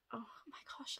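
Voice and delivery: breathless whispered awe; hushed, amazed whisper; awed whisper. Real, not acted. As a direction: whisper it, stunned by the colour. Overwhelmed at free box.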